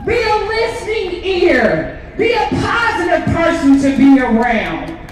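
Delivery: fervent rally speech, slow and loud; preaching. Real, not acted. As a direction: preach it to the crowd.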